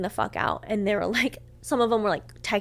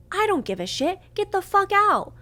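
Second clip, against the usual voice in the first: exasperated, escalating outburst; furious dismissal; 10 semitones higher, 4 dB louder than usual. Retold or imitated. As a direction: order them out, building to a shout. Retold order to leave.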